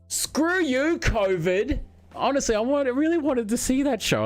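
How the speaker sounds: high, exasperated outburst; mock frustration. Real, not acted. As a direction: vent it in a high squeal. Show cancelled by covid.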